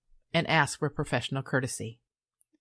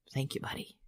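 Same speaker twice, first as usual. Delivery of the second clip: hushed, tender thank you; tender whisper; 9 dB quieter than usual. Real, not acted. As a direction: breathe it to the grandson, much softer. Thanking grandson, tender aside.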